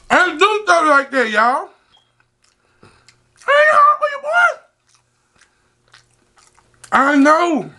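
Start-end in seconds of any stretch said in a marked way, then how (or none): 3.5-4.6 high excited shout; hyped shout. Real, not acted. Hyped calling out.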